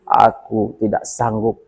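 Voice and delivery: suddenly low and soft; hushed, low-pitched refusal after the outburst; subdued refusal. A narrator's reading. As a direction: drop the voice for a low, quiet refusal. Iblis's refusal, drops after outburst.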